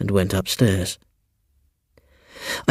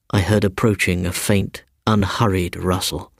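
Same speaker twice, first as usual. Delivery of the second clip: ordinary delivery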